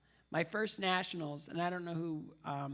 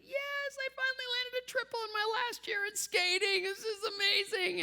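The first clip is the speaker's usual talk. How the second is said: much higher, triumphant yelp of joy; thrilled cheer; 4 dB louder than usual. Retold or imitated. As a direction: burst out as if you just landed the jump. Finally landing the triple jump.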